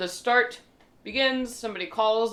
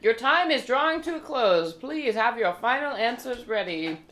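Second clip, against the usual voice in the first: quiz host; 6 semitones higher, 4 dB louder than usual. Acted. MC calls time.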